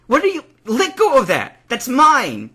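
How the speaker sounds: high, loud protest; outraged shouting. A narrator's reading. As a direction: shout at someone grabbing you. Tail is grabbed, protesting.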